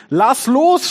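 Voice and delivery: high, loud storybook shout; shouting friends. A narrator's reading. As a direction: call up to a friend in a tree. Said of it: "schrien die Freunde".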